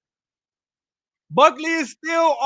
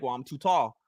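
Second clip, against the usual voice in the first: much lower, unbothered mock reply; smug fighter voice; 11 semitones lower, 5 dB quieter than usual. Commentator voicing the fighter brushing off a flying knee, deep and smug. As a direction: drop the voice deep and shrug it off. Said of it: "Usman's like".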